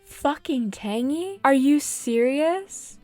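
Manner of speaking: high, loud, slow incredulous complaint; horrified by who just moved in; disbelieving outrage. Real, not acted. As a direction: stretch out each word in disbelief. Dismayed by new villager.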